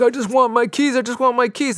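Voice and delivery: high, whiny repeated plea; mock pleading. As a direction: play the friend begging for his keys. Ephraim pleading with valet.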